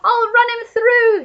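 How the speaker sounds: high, loud, slow blustering war cry; old Duke shouting at the burglar. A narrator's reading. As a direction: roar it brandishing a sword. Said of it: "he shouted".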